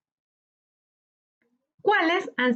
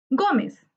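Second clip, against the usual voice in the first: Gómez with GÓ pitched higher; stress on first syllable; 4 semitones higher than usual. Shown as a demonstration. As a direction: lean on the GÓ in Gómez, then drop. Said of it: "tónica está en go". (acento en la primera sílaba de Gómez)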